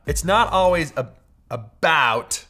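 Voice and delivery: loud American "about"; American accent. Shown as a demonstration. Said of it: "is that how an American would say it".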